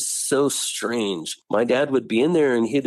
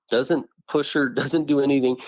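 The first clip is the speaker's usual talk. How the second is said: ordinary delivery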